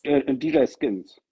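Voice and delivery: deutlich langsam gesagt. Real, not acted. Spells out DJ name clearly.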